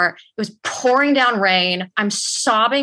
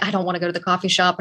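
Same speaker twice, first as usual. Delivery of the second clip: freaking out; much faster than usual. Retold or imitated. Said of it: "freaking out about it".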